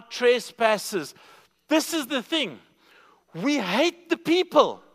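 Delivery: high, emphatic scripture proclamation; impassioned preaching. Real, not acted. Preacher confronting congregation with God's great love, impassioned preaching. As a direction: build to a loud, slow, punched finish.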